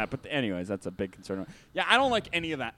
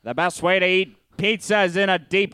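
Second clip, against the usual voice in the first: put-on louder old-timey Mid-Atlantic barker; Mid-Atlantic carnival barker pitch; Mid-Atlantic accent; 2 semitones higher, 5 dB louder than usual. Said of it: "best Mid-Atlantic impression".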